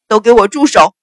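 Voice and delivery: very loud, commanding roar; furious shout. A narrator's reading. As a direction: bellow at everyone to stop. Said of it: "怒喝道".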